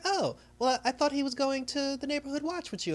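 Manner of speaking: high-pitched voice for her; imitated woman. Woman answering about the neighborhood watch, imitated high voice. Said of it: "And she's like".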